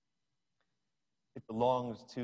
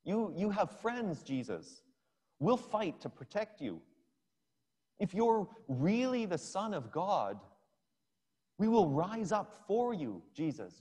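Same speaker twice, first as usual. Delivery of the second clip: higher, urgent pleading rush; blurting out; 6 semitones higher, 3 dB louder than usual. Retold or imitated. Peter pleading with Jesus to fight back, urgent and higher. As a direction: Peter begging Jesus to let them fight. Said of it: "So he blurts out".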